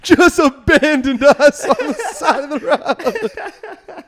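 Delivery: high, loud, drawn out through laughter; laughing hard. Real, not acted. Storyteller telling how the driver left them, laughing hard. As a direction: crack up while saying it. Cracking up at his own story.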